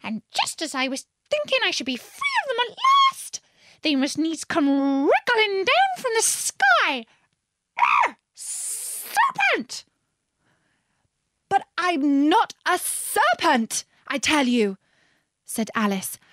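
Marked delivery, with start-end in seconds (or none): 0.0-9.9 high rant rising to a screech; shrieking. A narrator's reading. Pigeon ranting at Alice about serpents, shrieking. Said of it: "raising its voice to a shriek". 11.5-14.8 protesting. A narrator's reading. Alice denying the pigeon's accusation, protesting.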